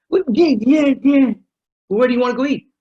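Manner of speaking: high, loud sputtering frustration; mock exasperation. Spouse after every dinner idea is refused, mock exasperated.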